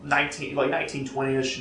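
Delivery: ordinary talk, nothing marked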